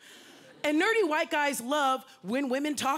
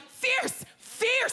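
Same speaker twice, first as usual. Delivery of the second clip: shouted fierce; 13 semitones higher than usual. Retold or imitated. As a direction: hype her up like a cheerleader. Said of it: "two other guys are like".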